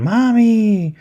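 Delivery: put-on childlike wail. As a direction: cry out like a scared little boy calling his mom. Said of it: "while going".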